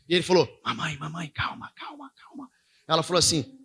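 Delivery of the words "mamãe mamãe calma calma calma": soft, breath-only hushing, acted out; imitated hushed child. Son telling his mother to calm down, imitated whisper. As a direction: drop into a whisper, voicing a little boy.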